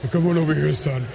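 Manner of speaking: put-on deep God voice, dropping on "son". Preacher demonstrates how he imagines God, in a deep voice. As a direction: drop low like God summoning a son. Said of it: "I imagine God with a deep voice".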